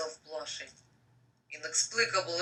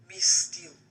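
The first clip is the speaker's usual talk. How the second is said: whisper; 4 dB louder than usual. Acted. Whispered character line.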